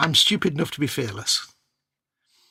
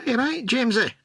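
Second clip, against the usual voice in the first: higher mock Glaswegian accent; a friend's playful Glaswegian accent; Rab C. Nesbitt; 9 semitones higher than usual. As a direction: throw out a cheeky Glaswegian greeting. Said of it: "Rab C. Nesbitt in the house".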